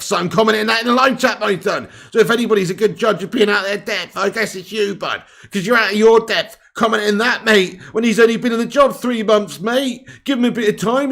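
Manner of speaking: loud, high scolding tease; mocking rant. Real, not acted. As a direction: rip into the viewer, jeering. Rant at a chat commenter.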